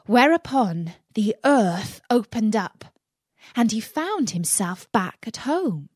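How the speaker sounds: plain reading voice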